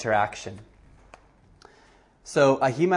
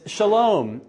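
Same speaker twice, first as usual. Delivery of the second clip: higher, louder eager shalom; joyful shalom; 7 semitones higher, 4 dB louder than usual. Retold or imitated. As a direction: call out shalom happily. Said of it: "he says".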